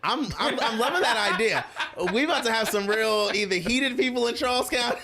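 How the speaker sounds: excited talk through laughter; laughing. Real, not acted. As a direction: laugh while pitching the idea. Laughing about the idea.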